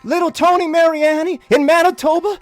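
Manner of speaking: thick South Carolina twang, oozing love; thick South Carolina accent. Acted. Host sending love to the family in a thick South Carolina accent. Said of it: "the very thick kind of South Carolina accent".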